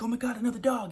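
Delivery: imitated excited pup. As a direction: squeal it lightly like an eager dog spotting company. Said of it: "He's, like, so excited".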